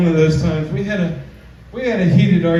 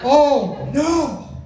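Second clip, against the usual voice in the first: mock gasp; 8 semitones higher than usual. Mock horror at own line.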